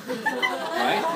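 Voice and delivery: breathless laugh through the word; real laughter cracking the word. Real, not acted. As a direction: laugh it out, high and loud. Laughing at own joke.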